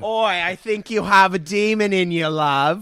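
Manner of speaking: high, loud mock British accent; badly faked British "Oi, love" voice; Russell Brand. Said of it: "Russell Brand".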